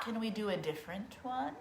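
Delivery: put-on quiet librarian voice for the kids; librarian hush. Shown as a demonstration. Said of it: "the librarian version".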